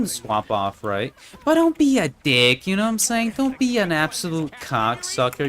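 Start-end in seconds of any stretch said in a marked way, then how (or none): 1.4-5.5 raised voice. Real, not acted. Heated advice to gamers.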